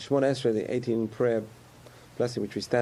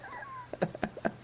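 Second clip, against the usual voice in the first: mock giggle; 10 dB quieter than usual. Mimicking laughing questioners.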